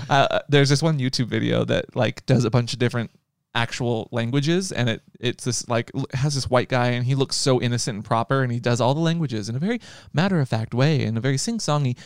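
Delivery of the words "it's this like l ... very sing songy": ordinary talk, nothing marked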